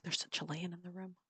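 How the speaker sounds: a quiet, delighted aside under your breath; murmured aside on spotting someone; hushed aside. Retold or imitated. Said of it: "I'm like".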